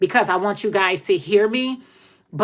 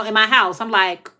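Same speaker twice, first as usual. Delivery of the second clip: ordinary delivery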